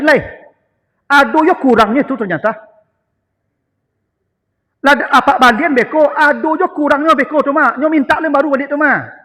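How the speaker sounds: loud, high whining wife; nagging wife. Retold or imitated. Wives demanding gifts, mimicked. (meniru istri yang merengek keras)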